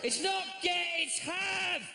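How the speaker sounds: high, shouted, slow pedantic rant. Pretend. Comic high shouted rant at a coffee customer about grammar. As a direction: lecture them furiously on get and have.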